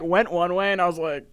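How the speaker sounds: ordinary talk, nothing marked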